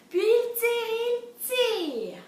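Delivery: high, loud storytelling chant; straining pull refrain. A narrator's reading. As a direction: heave on every tire. Story's repeated pulling refrain.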